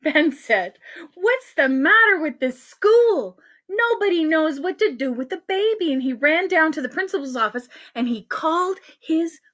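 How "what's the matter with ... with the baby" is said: put-on exasperated boy. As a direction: complain like a fed-up child. Ben fed up with adults.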